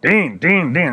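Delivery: Vince McMahon. Retold or imitated. Boss calling after him as the elevator closes, retold yell. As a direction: imitate someone calling urgently down a hall. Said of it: "I hear Vince McMahon yelling".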